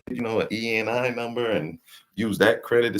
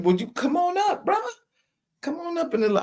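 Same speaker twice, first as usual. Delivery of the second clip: excited invitation. Real, not acted. Calling a guest onto the panel.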